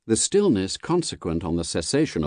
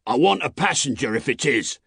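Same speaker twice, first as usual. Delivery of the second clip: raised voice of a distant rider; calling from the mist; 6 semitones higher than usual. A narrator's reading. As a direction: call your answer across to the guard. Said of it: "a man's voice called from the mist".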